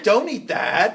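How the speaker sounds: high, loud comic creature voice; Yoda. Retold or imitated. Podcaster acting out the crazy alien master, high mock voice. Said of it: "I can't do Yoda".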